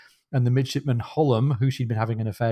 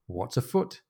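soft, innocent query; playing innocent; 5 dB quieter than usual. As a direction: ask it quietly, as if you know nothing. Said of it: "[name]'s playing the, the innocent".

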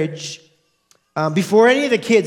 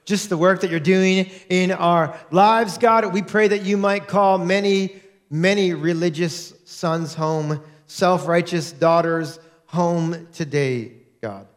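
prayer; 4 semitones lower than usual. Real, not acted. Pastor praying before sermon.

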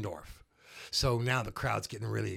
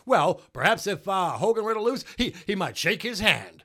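higher, louder announcer voice; Vince McMahon impression; 6 semitones higher, 5 dB louder than usual. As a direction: do Vince calling it. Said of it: "Vince McMahon is saying".

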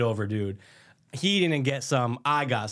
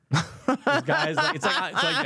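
laughing; 11 semitones higher, slower than usual. Real, not acted. Laughing through the retelling.

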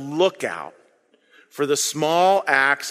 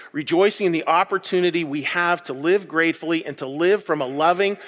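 ordinary delivery

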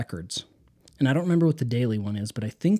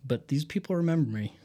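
ordinary talk

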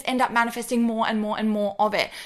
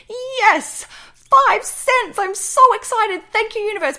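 high, loud, gushing delight over a coin; thrilled squeal; 10 semitones higher, 6 dB louder, slower than usual. Pretend. Listener finding five cents on the ground, squealing with high, loud delight. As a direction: squeal with joy like you found treasure. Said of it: "picking it up and being like".

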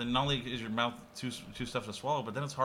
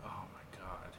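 whispered; 5 semitones lower, 16 dB quieter, much slower than usual. Real, not acted. Awkward question about online nicknames.

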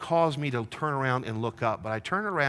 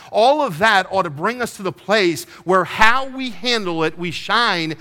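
loud, raised, ringing sermon voice; preaching at full force; 5 semitones higher, 8 dB louder than usual. Acted. Pastor urging congregation to shine, preaching loud. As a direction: drive the message home to the church.

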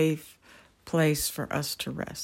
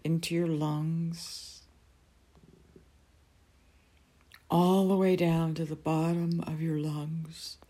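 guided meditation. Acted. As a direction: ease them gently into calm.

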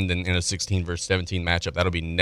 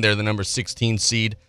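ordinary delivery

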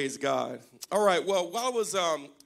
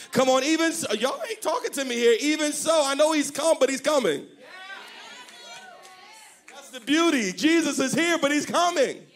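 higher, half-sung preaching; full-force preaching; 10 semitones higher than usual. Acted. Pastor quoting Paul to the church, half-sung preaching. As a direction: climb into a singing preach.

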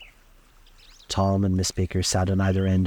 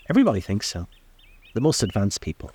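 ordinary delivery, nothing marked

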